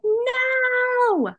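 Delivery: high, drawn-out cry of refusal; retold yell. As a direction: re-enact a yell, pitch leaping up without full volume. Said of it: "I was yelling at God".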